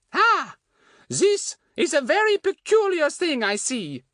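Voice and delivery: high, slow French fortune-teller voice; French woman's accent. A narrator's reading. Fatty re-enacting his hand reading, French woman's accent. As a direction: intone mysteriously with a put-on French accent. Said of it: "sounding like the Frenchwoman again".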